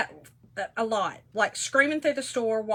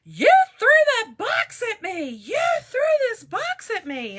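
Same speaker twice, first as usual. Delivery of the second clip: imitating a lady screaming at the top of her lungs; high, loud outraged screech in imitation; retold screaming; 12 semitones higher, 9 dB louder than usual. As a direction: mimic an indignant woman yelling across a store. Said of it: "screaming to the top of her lungs".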